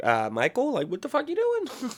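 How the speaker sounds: high put-on parent voice, baffled; mock parents. Parents asking young Michael what he's doing, high and baffled. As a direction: squeak out a shocked parent's question. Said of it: "his parents are like".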